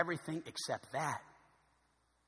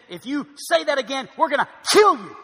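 loud, high threat building to a hard KILL; shouted threat; 7 semitones higher, 14 dB louder than usual. Acted. Opposers threatening Peter for preaching the gospel, shouted threat. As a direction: deliver it as a dramatic, shouted warning.

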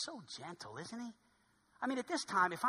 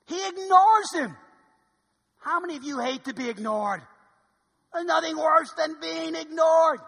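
loud, higher pleading preach; rising sermon shout; 6 semitones higher, 9 dB louder than usual. Acted. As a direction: drive the point home loud. Preaching at full force.

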